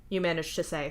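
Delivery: ordinary delivery, nothing marked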